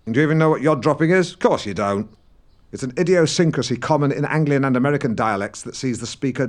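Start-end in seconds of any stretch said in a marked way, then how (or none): none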